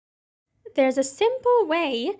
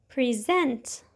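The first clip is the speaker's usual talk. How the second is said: "present" with the stress on SENT; second-syllable stress; 3 semitones lower than usual. Shown as a demonstration. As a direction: say "present" as the verb, pri-ZENT. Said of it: "on the second syllable".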